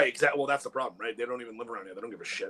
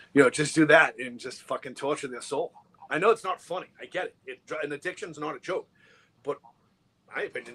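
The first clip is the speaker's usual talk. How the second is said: ordinary talk, nothing marked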